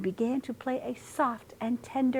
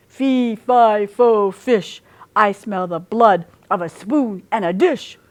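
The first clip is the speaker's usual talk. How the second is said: loud, booming giant's chant; 8 dB louder than usual. A narrator's reading. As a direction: boom it from far away. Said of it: "a voice boomed".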